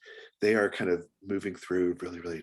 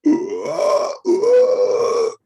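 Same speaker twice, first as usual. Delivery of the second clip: much higher, louder mock boar squeals; squealing pig noises; 13 dB louder, much slower than usual. Masked woman scaring the kids, loud high pig squeals. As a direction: squeal like a wild boar leaping out. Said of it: "she's doing her pig noises".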